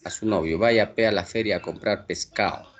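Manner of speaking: ordinary delivery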